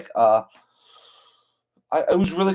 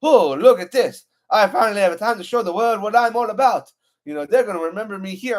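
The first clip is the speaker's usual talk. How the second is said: lightly voiced hero's boast, higher pitched; imitating Gambit; 6 semitones higher, 4 dB louder than usual. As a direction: act out an eager hero's big moment. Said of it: "and Gambit's like".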